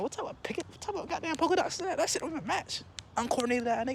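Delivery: mock it in a small, high voice; incredulous mockery. Teller mocking a clown's polka dots mid-danger, high incredulous undertone.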